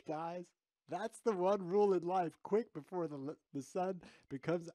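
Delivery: high voice choked with laughter; laughing to tears. Real, not acted. Streamer urging chat to follow his rule, laughing to tears. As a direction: declare it while cracking up. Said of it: "it's making me cry".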